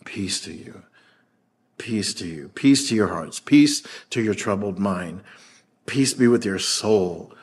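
soft, low, tender blessing; calm their hearts gently; quiet reassuring peace; soothing Jesus. Acted. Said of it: "So he says to them".

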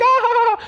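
high-pitched mock sob of joy; happy-crying wail. Crying woman greets the arriving pastor, mock happy wail. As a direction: imitate a joyful, tearful "ah" far above your voice. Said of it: "It's like tears of joy".

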